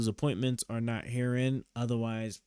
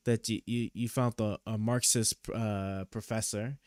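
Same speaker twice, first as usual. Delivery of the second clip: ordinary talk, nothing marked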